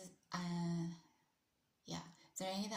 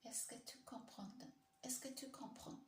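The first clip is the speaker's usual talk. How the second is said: higher, softer French accent attempt; 5 semitones higher, 8 dB quieter than usual. Shown as a demonstration. Streamer practising how to ask "do you understand" in French, tentative French accent. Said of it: "this accent's all wrong".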